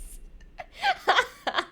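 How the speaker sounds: high, bursting laugh; loud cackle. Real, not acted. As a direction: crack up loudly. Laughing about the character.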